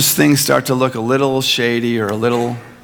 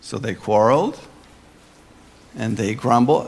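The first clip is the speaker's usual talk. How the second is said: ordinary talk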